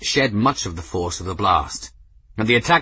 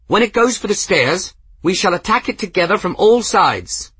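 higher and louder battle order; rallying shout; 11 semitones higher, 7 dB louder than usual. A narrator's reading. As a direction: command the crowd to fight. Rallying survivors to attack.

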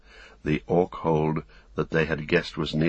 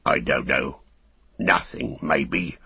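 orc soldier; 3 semitones lower than usual. Acted. Soldier answers.

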